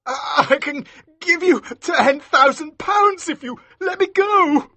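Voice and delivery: high, stammering fearful bribe; voice shaking. A narrator's reading. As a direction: stammer out the offer, terrified. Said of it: "his voice shaking".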